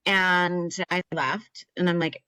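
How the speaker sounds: ordinary delivery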